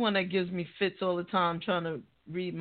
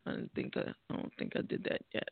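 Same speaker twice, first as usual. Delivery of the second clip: barely voiced self-talk; whispered muttering; 11 dB quieter, faster than usual. Real, not acted. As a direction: mutter it under your breath, quick.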